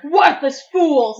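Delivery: slow, loud spitting curse; spat curse. Acted. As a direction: spit the words out in fury. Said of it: "Spat a curse".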